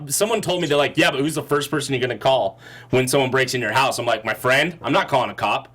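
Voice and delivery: ordinary talk, nothing marked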